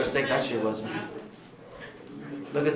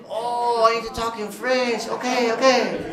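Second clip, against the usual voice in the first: mock realization; 7 semitones higher, slower than usual. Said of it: "mom realizes".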